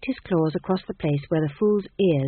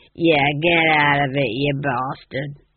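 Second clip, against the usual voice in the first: rough, louder "get out" at a cat; gruff shooing; 3 semitones lower, 4 dB louder, slower than usual. A narrator's reading. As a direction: shoo the cat off, slow and coarse.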